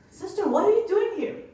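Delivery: high, loud, puzzled demand; loud incredulous question. Retold or imitated. City official loudly asking the nun what she's doing. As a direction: call it out, amazed at the chaos.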